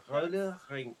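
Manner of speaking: Roller rink with slow, effortful American R's; careful roller rink. Shown as a demonstration. French speaker practising a hard word, effortful R's. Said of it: "it is hard for me to do those R's".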